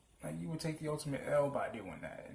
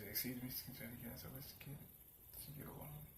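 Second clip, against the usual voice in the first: soft timid ask; 12 dB quieter than usual. Retold or imitated. Classmate timidly asking to get along.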